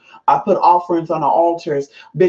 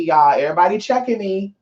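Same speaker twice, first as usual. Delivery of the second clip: ordinary talk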